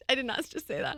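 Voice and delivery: high laughing disbelief. Real, not acted. Mom laughing after an awkward remark, laughing. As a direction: laugh at your own slip. Laughs at her own slip.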